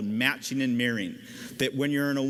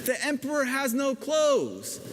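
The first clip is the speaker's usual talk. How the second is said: put-on much higher, a small child's voice; child's blurt; 11 semitones higher than usual. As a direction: blurt it out like a little kid. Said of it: "that one child truth told and said".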